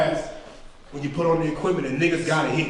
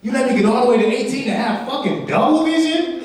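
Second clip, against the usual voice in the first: mock lament to God; 6 semitones higher than usual. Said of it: "sitting back like".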